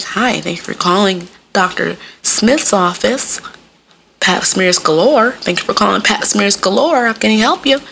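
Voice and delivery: put-on professional phone voice; receptionist. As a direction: answer the office line properly. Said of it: "They be like".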